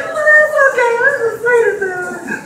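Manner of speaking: put-on very high, airy sweet-old-lady voice; high, gentle impression of a sweet lady forgiving a mistake. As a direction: coo it like the sweetest lady ever. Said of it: "She's like".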